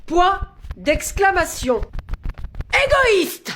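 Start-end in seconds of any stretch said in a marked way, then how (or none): 2.7-3.5 shouted dictation. Acted. Teacher shouting the dictation's exclamation. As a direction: yell it out. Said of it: "ça va pas de crier comme ça".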